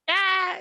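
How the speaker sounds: high, held "ah"; imitated cry. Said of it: "He goes".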